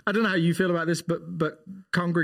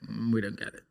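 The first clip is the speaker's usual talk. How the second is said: flat unimpressed; 8 semitones lower, 7 dB quieter than usual. Retold or imitated. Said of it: "they're just like".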